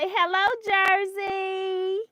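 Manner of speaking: high, slow sing-song hello; high slow cooing hello to a dog; baby talk. Pretend. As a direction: coo the greeting for the child. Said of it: "They say".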